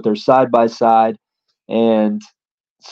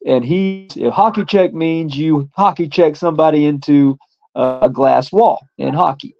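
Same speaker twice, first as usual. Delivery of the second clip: plain reading voice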